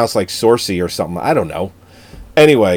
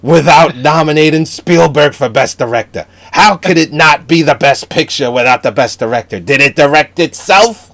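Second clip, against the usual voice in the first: high, loud, indignant mock rant; impassioned mini speech; 5 semitones higher, 4 dB louder than usual. Retelling his buddy's speech about Spielberg, mock-indignant. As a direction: plead the case loudly, hammed-up. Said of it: "he gives this little mini speech".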